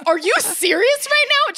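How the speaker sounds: high-pitched mock disbelief; retold incredulity. Daughter spots regifted present, acted high incredulity. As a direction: demand it, acting out being scandalized.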